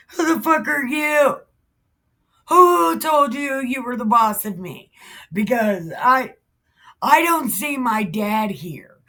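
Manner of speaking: indignant, high-pitched comic back-talk; hammed-up kid brother challenging his elder; mock defiance. David talks back to brother.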